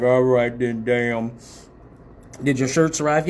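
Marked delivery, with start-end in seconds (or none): 0.0-1.3 put-on deep voice; Mister Motherfucker. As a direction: mimic a man with a very low phone voice. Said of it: "when I do the motherfucker voice".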